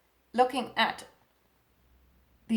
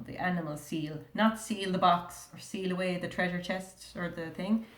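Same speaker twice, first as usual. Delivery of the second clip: usual speaking voice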